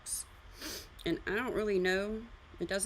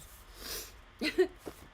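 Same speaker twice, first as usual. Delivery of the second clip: high breathless laugh; 10 semitones higher than usual. Real, not acted. Laughs at own forgetfulness.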